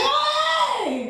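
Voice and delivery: very high, bright surprised hello; mock cheery greeting. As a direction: greet her like a happy reunion.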